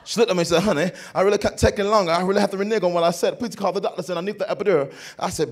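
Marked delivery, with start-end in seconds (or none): 0.6-4.9 fast, higher, louder London wife; mimicked British accent. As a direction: plead quickly in a put-on British accent. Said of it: "she talked with this accent just like this".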